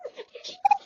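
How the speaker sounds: high held-back shriek; mock scream. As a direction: re-enact a labor scream at talking volume. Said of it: "I'm screaming my head off".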